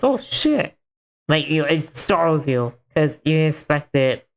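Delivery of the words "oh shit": startled curse. Retold or imitated. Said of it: "you hear someone go".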